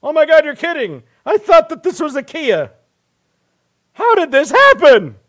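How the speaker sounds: high, exaggerated fake amazement; sarcastic driver answering people who yell at him; sarcastic mock shock. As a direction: gush over the car as if you had no idea. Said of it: "I'm like".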